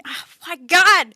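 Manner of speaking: loud frustrated groan. Real, not acted. Player frustrated at her own slip.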